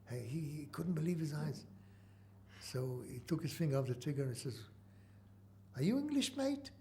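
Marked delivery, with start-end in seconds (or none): 5.7-6.7 lightly voiced soldier, pitched much higher; a hint of a puzzled British corporal; mock soldier asking, higher. Soldier voiced in retold story.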